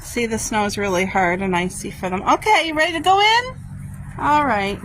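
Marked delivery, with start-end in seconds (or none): 2.2-4.8 high, loud pet-talk question. Real, not acted. Owner asks her dogs to go in, high coaxing call. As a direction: ask the dog brightly, pitched way up.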